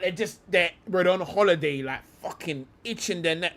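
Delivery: ordinary talk, nothing marked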